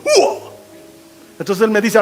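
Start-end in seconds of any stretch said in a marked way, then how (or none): 0.0-0.4 very high hooting kiai; imitated teacher's yell. Preacher showing how his taekwondo teacher yelled, high hoot. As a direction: mimic the master's odd high cry. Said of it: "porque así gritaba mi maestro".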